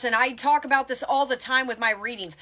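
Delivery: ordinary delivery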